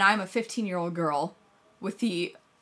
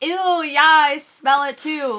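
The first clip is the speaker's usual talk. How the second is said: loud, high fake disgust; playing it up; 9 semitones higher, 12 dB louder than usual. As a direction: overdo the grossed-out act for the room. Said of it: "like, playing it up".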